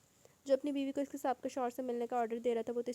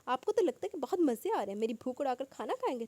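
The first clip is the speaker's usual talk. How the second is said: louder, higher exasperated jab; huffy retort; 4 semitones higher, 6 dB louder than usual. A narrator's reading. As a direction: bristle and bite back. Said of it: "وہ بھنا کر اٹھی". (جھنجھلا کر طنز)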